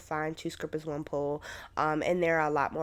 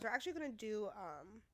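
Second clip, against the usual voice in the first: usual speaking voice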